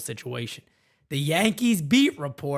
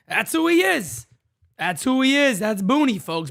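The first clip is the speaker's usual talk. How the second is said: higher, punchy; excited declaration; 8 semitones higher, 4 dB louder than usual. Real, not acted. Host bragging about the caller, excited. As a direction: proclaim it proudly.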